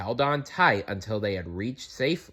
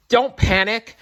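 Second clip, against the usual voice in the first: high, loud scold; 13 semitones higher, 6 dB louder than usual. A narrator's reading. Mother rabbit scolding her babies, a high loud scold. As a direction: tell the little ones off. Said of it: "their mother scolded".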